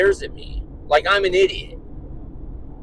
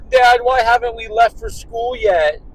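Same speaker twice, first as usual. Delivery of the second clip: higher, louder mock-upset little girl; imitated crying daughter; 5 semitones higher, 5 dB louder than usual. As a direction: whine at dad like a tearful child. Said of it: "my daughter's crying now".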